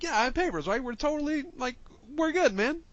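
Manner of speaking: high, loud silly traveller voice. Retold or imitated. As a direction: squeak it out like a cheerful old man. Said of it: "And he was like".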